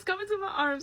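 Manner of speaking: can barely get it out for laughing; laughing through quote. Retold or imitated. Retelling his creepy line to viewers, laughing. Quoting date while laughing.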